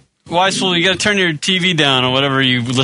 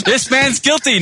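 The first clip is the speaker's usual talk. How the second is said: mock courtroom verdict; 10 semitones higher than usual. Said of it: "Johnny Cochran".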